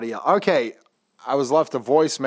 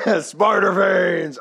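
slow, drawn-out shout, pitched up; metal shout; 5 semitones higher, 4 dB louder, slower than usual. Shown as a demonstration. Host relishing the phrase to his co-host, slow metal shout. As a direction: belt it like a metal frontman. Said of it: "It's more metal".

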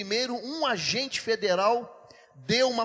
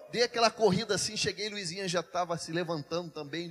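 ordinary talk, nothing marked

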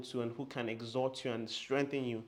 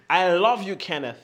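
loud declaration; 4 semitones higher, 12 dB louder than usual. Shown as a demonstration. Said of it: "As loud as you can".

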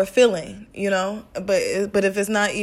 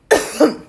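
cough; 8 semitones higher, 7 dB louder than usual. Real, not acted. Oh, excuse me.

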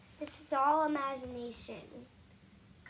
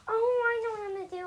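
sudden loud excited realization; excited idea; 9 semitones higher, 11 dB louder than usual. Real, not acted. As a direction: burst out with the idea. Sudden idea after a gasp.

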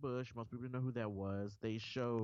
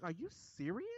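pitched-up disbelief; incredulous; 7 semitones higher than usual. Retold or imitated. Reacting to ignorant college students, high incredulous voice. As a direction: ask it stunned, unable to believe it.

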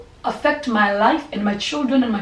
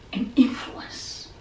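whisper; 4 dB quieter, much slower than usual. Real, not acted. Whispered close to the preached point.

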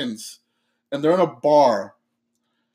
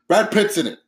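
normal talking voice

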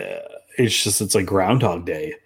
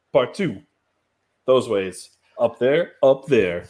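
hammed-up host intro; 6 semitones higher than usual. Host welcoming listeners in a hammed-up intro voice. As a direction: roll out the episode intro like a mock announcer. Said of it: "Nobody does intros like you".

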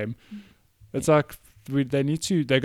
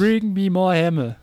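mock Thor; 6 semitones higher, 4 dB louder than usual. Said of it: "talks in an Australian accent".

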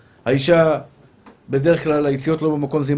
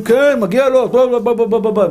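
louder, higher, rapid-fire clamour of prosecutors; accusers; 5 semitones higher, 5 dB louder than usual. Acted. Heavenly prosecutors demand a man's punishment, loud high clamour. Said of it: "המקטרגים אומרים".

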